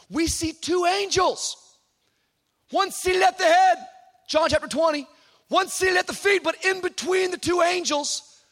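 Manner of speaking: high, ringing proclamation; full-force preaching. Real, not acted. Preacher proclaiming that grace got out, fervent and high. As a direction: build to a fervent pulpit cry.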